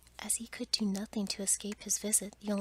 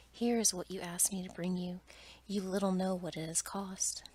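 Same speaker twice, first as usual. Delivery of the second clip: ordinary delivery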